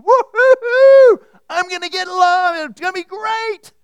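high, loud whooping glee; gleeful worker. Retold or imitated. Worker gloating over his expected pay, whooping high and loud. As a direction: crow with delight over a big payday. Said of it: "the first guy is going".

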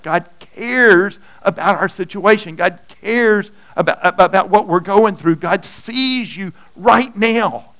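loud, rising sermon voice; preaching at full force. Acted.

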